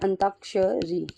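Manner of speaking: Antakshari drawn out slowly, syllable by syllable; slow model word. Shown as a demonstration. Teacher repeating the right word slowly for students. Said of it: "antakshari ऐसे लिखा जाता है".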